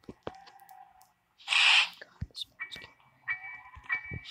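hushed whisper while hiding. Real, not acted. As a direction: breathe it out so she can't hear. Quietly grumbling while hiding in game.

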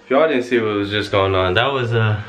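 normal talking voice

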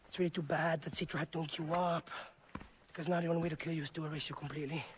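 normal talking voice

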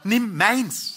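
high-pitched eager offer; urgent wife. Acted. Wife offering her knife to husband, high urgent voice.

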